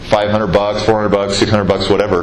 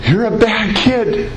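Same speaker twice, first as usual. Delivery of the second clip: retold angry telling-off in a raised pitch; imitated yelling mother; 9 semitones higher than usual. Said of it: "she keeps yelling at me".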